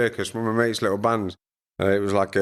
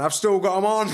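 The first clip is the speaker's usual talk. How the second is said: laughing; 10 semitones higher than usual. Retold or imitated. Retelling his reply about the pants.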